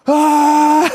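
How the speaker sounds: high shrieking fan scream; sudden high fangirl shriek. Retold or imitated. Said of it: "And I scream".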